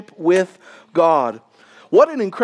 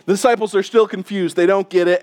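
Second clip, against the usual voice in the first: usual speaking voice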